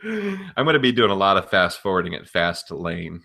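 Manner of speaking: ordinary talk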